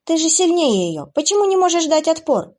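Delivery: high, loud desperate yell; sudden shout. Acted. Abby shouting at the weakened demon Kevin. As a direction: cry out at him in frustration. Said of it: "вдруг закричала ему Эбби".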